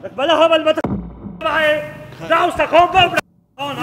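loud furious yelling at him; angry shout. Acted. Quarrel with driver over car.

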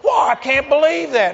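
high, loud mock gasp of disbelief; offended disbelief. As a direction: imitate a shocked, offended man. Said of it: "he got so offended".

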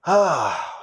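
a long, high theatrical sigh ah; big sigh. Shown as a demonstration. Host shows off his sighing to listeners, hammed-up sigh. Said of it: "I can sigh with the best of 'em".

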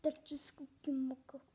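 breathy unvoiced whisper. Shown as a demonstration. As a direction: whisper it under your breath. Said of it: "That should be a song".

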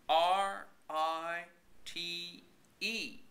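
letters spelled out slowly; slow spelling. A narrator's reading. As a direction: spell each letter deliberately. Spelling the word out.

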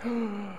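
sharp high intake of breath; startled gasp. Retold or imitated. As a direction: gasp as if caught out. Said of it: "it was like".

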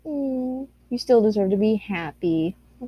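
normal talking voice

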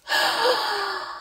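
high, soft shocked gasp; shocked intake of breath at the screen; sharp gasp. Real, not acted. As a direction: gasp in sudden dismay. Shocked at game event.

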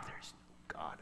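spent, tearful whisper; crying whisper. Retold or imitated. After shouting at the bay, a spent tearful whisper. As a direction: let it collapse into a breath. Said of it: "I was crying".

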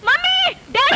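a sudden frightened yell to the parents; startled scream. Acted. Girl startled in bed calls her parents, screaming. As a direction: cry out in alarm for help. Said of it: "ఏంటి అలా అరిచావు?".

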